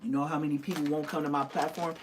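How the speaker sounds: usual speaking voice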